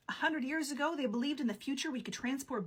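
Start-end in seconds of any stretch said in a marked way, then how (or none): none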